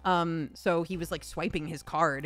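ordinary delivery

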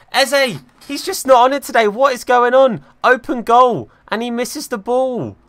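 high, loud cry of disbelief at the miss; exasperated shout. Real, not acted. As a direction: yell it like you can't believe what you saw. Striker misses an open goal.

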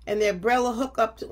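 plain reading voice

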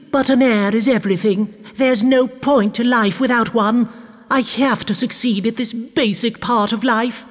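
high-pitched, desperate wife's voice; distressed baroness. A narrator's reading. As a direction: lament as a childless baroness.

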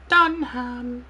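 high squeaky chipmunk voice; helium voice. Real, not acted. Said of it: "sounding like a chipmunk".

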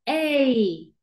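drawn-out "ey"; long A sound. Shown as a demonstration. Teacher naming the long sound, stretched "ey". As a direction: hold "ey" long, gliding to i. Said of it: "Sonido largo".

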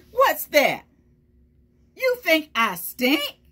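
cocky truck bragging about its stink; proud smelly truck. Acted. Truck taunting the kids, cocky and loud. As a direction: brag it out, teasing the listeners.

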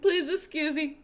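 high, loud, close to tears; tearful delight. Real, not acted. Overcome by her dressed-up chickens, tearful and high. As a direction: gush through happy tears. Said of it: "I'm trying not to sniffle".

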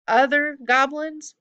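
high, loud, drawn-out offended echo; indignant goblin. Acted. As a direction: repeat it slowly in affront. Goblin offended at being misnamed.

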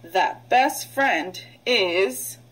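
very slow, drawn out, leaving a gap to fill; slow cloze read. Shown as a demonstration. Teacher slowly reading so students fill the blank. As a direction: stretch it out so the class finishes it. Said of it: "read aloud pausing for class to fill".

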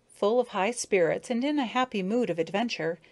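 usual speaking voice

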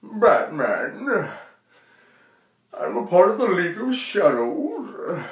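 a hint of Bane's muffled voice; lightly voiced Bane villain. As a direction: say it like Bane, menacing. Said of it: "Bane would say".

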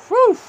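high, loud sigh of relief; loud relief whew. Real, not acted. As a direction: blow out the tension. Relief after miss.

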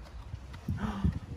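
a sharp unvoiced gasp of wonder; excited gasp. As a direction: gasp as if revealing a surprise. Dramatic gasp before each scientist.

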